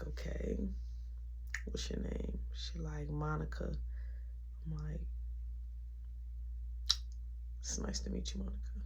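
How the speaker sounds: whispered, playing it cool. To Monica, whispered cool tease. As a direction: hush it like you're too cool. Smooth talk with the girl.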